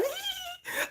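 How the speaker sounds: put-on high, soft girlish giggle. Smitten fan near [name] letting out a high, soft girlish giggle. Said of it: "I was giggling too".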